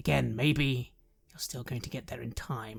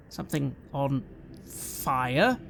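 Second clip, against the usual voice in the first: usual speaking voice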